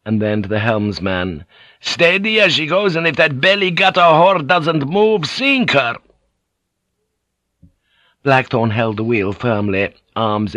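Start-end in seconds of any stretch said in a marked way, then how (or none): 1.8-6.0 high, loud battle command; shouted orders. Acted. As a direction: roar it at the helmsman.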